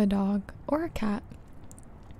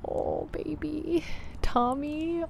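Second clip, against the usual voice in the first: cooing; 6 semitones higher, slower than usual. Real, not acted. Cooing at cute pet name.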